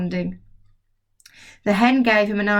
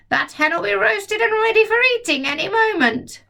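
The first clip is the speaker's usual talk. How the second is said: put-on higher, gleeful old woman's cackle-talk; grandma shouting; 12 semitones higher than usual. Grandma gloating from the roof about the burning hen. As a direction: relish the doom. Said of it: "Grandma shouted".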